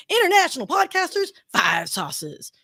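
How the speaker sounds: slow, low, drawn-out announcer voice; dramatic trailer voiceover. Acted. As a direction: growl the challenge like a film trailer. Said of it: "I did that voiceover".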